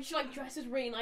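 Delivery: normal talking voice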